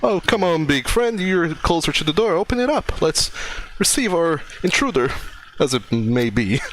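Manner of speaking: high, lilting character voice; theatrical, high-pitched urging; Finasair. Acted. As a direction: coax a friend in a sing-song high pitch. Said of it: "And Finasair says".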